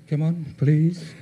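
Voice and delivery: normal talking voice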